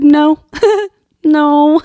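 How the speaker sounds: playful no no no, laughing; camera refusing. Pretend. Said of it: "my camera just said".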